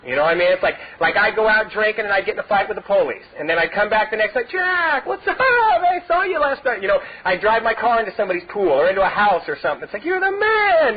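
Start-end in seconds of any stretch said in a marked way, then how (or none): 4.5-6.8 high, slow, hammed-up buddy greeting; buddies greeting him. Pals hailing the local wild man, in a high, slow mock greeting. 10.1-11.0 high mock praise for the man; admiring fan. Crowd hailing him after wild stunts, high mock admiration.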